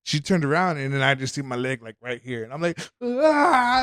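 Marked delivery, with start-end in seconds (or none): 3.0-3.8 loud, high mock scream on seeing the leg; a sudden short scream of shock; retold scream. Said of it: "I just start fucking screaming".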